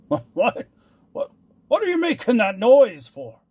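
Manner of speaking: loud, stammering outburst, climbing high; splutter, then demand it furiously. A narrator's reading. King turns on dwarf over noise, furious. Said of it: "turning furiously to the dwarf".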